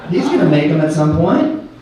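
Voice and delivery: ordinary talk, nothing marked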